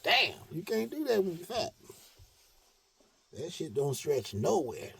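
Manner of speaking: ordinary delivery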